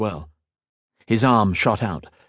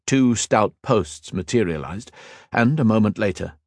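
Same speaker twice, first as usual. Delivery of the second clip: ordinary delivery, nothing marked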